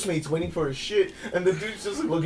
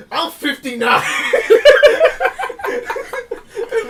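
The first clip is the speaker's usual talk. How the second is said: laughing; 13 semitones higher, 8 dB louder, much slower than usual. Real, not acted. Friend laughing while retelling an old man's boast. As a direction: crack up while quoting him.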